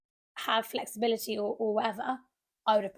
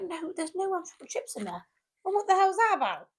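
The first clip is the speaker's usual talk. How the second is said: high-pitched outraged complaint; mock fuming; 6 semitones higher than usual. Griping to a friend about missing chocolate chips, mock outrage. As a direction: protest as if cheated, pitch climbing high.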